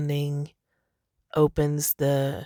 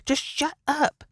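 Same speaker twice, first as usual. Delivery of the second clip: high, exasperated brush-off; 10 semitones higher than usual. Retold or imitated. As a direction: throw up your hands, higher and fed up. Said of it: "That's kinda how I feel".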